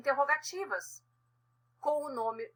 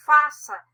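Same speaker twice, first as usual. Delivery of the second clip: Faça punched much louder as a firm order; commanding stress; 2 semitones higher, 10 dB louder than usual. Shown as a demonstration. Teacher demonstrating an order to the class, Faça hit loud and firm. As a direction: bark the word Faça like a command. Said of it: "eu estou mandando".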